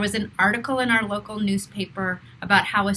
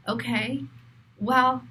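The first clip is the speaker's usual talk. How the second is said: normal talking voice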